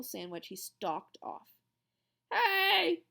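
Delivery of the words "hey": high, loud call after him; boy calling after a classmate, loud. A narrator's reading. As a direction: shout across the lunchroom. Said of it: "Ham called after".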